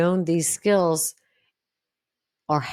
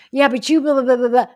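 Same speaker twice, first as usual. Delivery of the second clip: higher, louder whiny deflection with blahs; mock deflecting partner; 8 semitones higher, 7 dB louder, faster than usual. Imitating the person who drags up something unrelated, loud and whiny. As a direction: mimic someone dodging the point.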